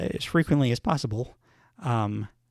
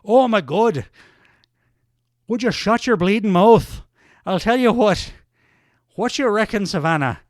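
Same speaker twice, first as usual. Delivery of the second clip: put-on higher, louder furious Irish accent; 6 semitones higher, 5 dB louder than usual. Sheamus fed up with Kaiser, angry Irish accent. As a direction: snap back in an angry Irish brogue.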